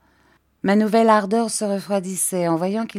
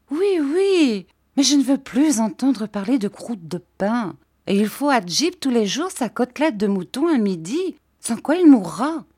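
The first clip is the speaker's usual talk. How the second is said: put-on high, petulant girlish voice; higher-pitched, lilting protest; childish Dora, insistent; 7 semitones higher, 3 dB louder than usual. A narrator's reading.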